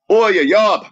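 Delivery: a hint of a Cockney hooligan calling out; Cockney accent. Shown as a demonstration. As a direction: bark it like a Cockney lad. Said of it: "the Cockney accent".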